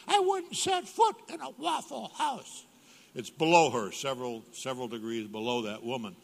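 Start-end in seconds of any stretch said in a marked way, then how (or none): 0.1-2.7 high, haughty falsetto wife; mock her prim disdain in a high voice; high snooty wife impression; mimicking his wife. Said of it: "to quote her".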